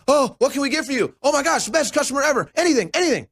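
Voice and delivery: very high, overeager mock waiter voice; high, pleading mock host gushing; desperate restaurant host. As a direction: fawn over the only customer in days. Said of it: "Someone walks in the door like".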